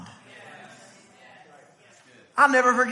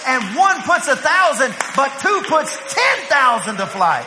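loud, emphatic preacher's shout; raised, forceful pulpit delivery; preaching climax; 2 semitones higher, 4 dB louder than usual. Real, not acted. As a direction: drive it home at full voice.

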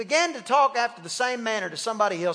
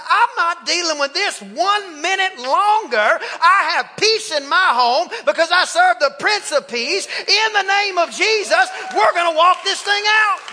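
loud declaration; 6 semitones higher, 6 dB louder than usual. Acted. Husband in his driveway declaring peace, building to a shout. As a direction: claim it like a battle cry.

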